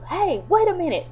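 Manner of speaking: high, sharp call after someone. Retold or imitated. Harrison spots the man with the hat, high call. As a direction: stop him before he gets away.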